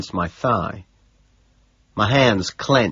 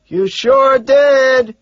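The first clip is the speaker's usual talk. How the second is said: very slow, high yell; drawn-out scream over noise; 8 dB louder, much slower than usual. A narrator's reading. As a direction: stretch each word into a loud holler. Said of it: "I scream".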